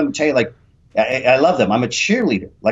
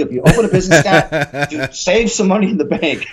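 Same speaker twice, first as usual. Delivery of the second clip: higher-pitched advice through laughter; laughing; 3 semitones higher than usual. Real, not acted. Podcast guest jokes to host about risking life savings, laughing. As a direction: laugh while giving the advice. Laughing at own risky gamble.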